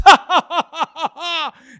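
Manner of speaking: booming, drawn-out mock laugh; loud hammed-up taunting cackle; mocking villain laugh. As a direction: laugh scornfully in bursts at a puny foe. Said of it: "begins to make fun of David".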